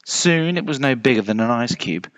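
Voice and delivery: usual speaking voice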